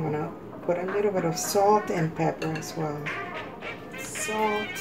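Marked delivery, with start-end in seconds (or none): none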